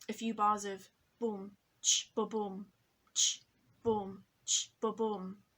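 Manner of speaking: "boom, ch, ba, boom" voiced like a kick and hi-hat; beatbox the intro drums lightly; drum beat imitation. Shown as a demonstration. Teacher imitates the intro drums by mouth. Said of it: "The introduction drums".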